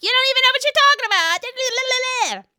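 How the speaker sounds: very high, rapid mock rant; Jennifer Aydin. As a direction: babble it shrilly into nonsense, overreacting. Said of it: "And then Jennifer's like".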